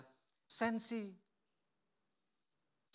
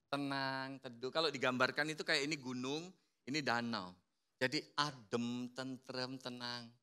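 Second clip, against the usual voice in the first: soft, slow, settled voice; calm and soothing; 4 semitones lower, 8 dB quieter than usual. Shown as a demonstration. Preacher describing peaceful phlegmatic people, soft and slow. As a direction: ease into stillness.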